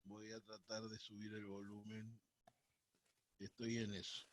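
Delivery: faint, distant voice; speaking well away from the mic; phone held away. Real, not acted. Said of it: "sí, un poquito bajito".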